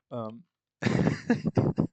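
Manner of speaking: loud laugh through the word; real laughter breaking in. Real, not acted. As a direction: laugh out at how silly it is. Laughing at the film.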